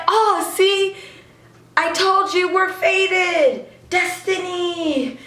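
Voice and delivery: high, slow, stretched-out mock proclamation; playful declaration of fate. Idol teasing a fan about destiny, playful declaration.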